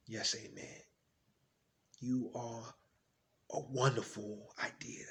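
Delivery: low, hushed fatherly reassurance; tender dad. Acted. Dad telling his son his worth, tenderly. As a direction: say it very softly, phrase by phrase. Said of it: "I asked him".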